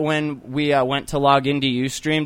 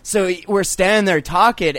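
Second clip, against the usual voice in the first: ordinary talk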